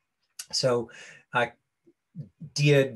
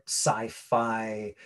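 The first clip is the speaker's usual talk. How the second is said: ordinary talk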